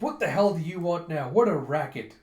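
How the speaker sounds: loud, annoyed outburst at being woken; grumpy yell. Retold or imitated. Gunte woken from his nap, annoyed. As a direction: bark it out, irritated. Woken general snaps at noise.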